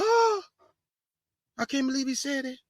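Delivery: high squeaky "Oh", then a soft, scandalised mutter; mock the timid listeners clutching their pearls; mocking shocked gasp. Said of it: "our own people be like".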